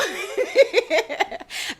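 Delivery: bright high burst of laughter; real laugh at her own joke. Real, not acted.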